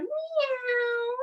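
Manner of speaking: high cat meow; kid-friendly cat sound; playful meow. Pretend. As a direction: meow like a kitty for the kids. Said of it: "curling your back, head and tail down".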